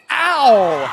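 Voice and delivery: loud, high "ow" then a drawn-out call; wincing exclamation. Real, not acted. Commentator seeing a player hit, wincing loudly. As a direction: react as if you felt the hit. Reacting to a painful hit live.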